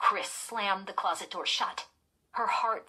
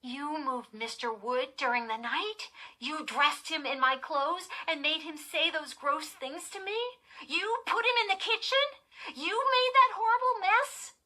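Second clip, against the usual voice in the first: high questions climbing louder and higher; mounting disbelief; 10 semitones higher, 6 dB louder than usual. A narrator's reading. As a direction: build from stunned to outraged accusation. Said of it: "in disbelief".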